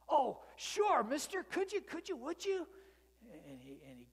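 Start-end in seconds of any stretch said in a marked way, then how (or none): 0.1-2.7 very high, loud, tripping over his words; a kid who can't wait to ride; excited little boy. Acted.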